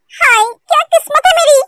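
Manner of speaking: normal talking voice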